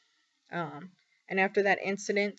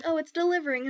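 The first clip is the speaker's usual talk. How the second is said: high, bright mock chirp; higher pitched mocking tone; mock cheerful staff; 10 semitones higher, 4 dB louder, faster than usual. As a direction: imitate their upbeat reassurance. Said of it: "They're like".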